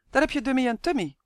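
brisk, relieved announcement; relieved call. A narrator's reading. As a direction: call out, glad of the distraction. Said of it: "riep ze opgelucht".

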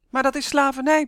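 high, loud indignant objection; protesting. A narrator's reading. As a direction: burst out in protest. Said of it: "protesteerde Ronnie". (luid, verontwaardigd protest)

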